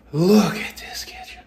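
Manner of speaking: slow, hushed whisper; awed whisper of admiration. Real, not acted. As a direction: breathe it out in awe, slowly. Showing off the kitchen, hushed.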